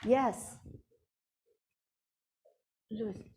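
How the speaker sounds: ordinary delivery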